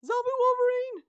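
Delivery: high, full-force yell of alarm; panicked shout. A narrator's reading. Boy spots escaped animal, panicked shout. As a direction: shout it at the top of your voice. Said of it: "Travis shouts".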